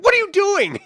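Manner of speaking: high, loud outraged yell; a shocked, shouting demand; indignant shout. Retold or imitated. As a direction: bellow it like a dad catching an intruder. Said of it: "He's like".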